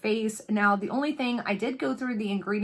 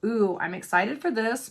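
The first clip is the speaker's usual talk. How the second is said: usual speaking voice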